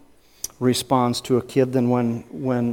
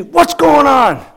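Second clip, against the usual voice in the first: high, loud mock dad yell; 11 semitones higher, 5 dB louder, slower than usual. Dad bursting in on his hurt son, yelling. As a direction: holler it like an alarmed, cross father. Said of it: "he yelled".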